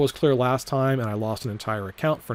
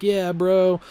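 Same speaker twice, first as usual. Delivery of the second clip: Joe's voice; 6 semitones higher, 4 dB louder than usual. Retold or imitated. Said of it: "And Joe's like".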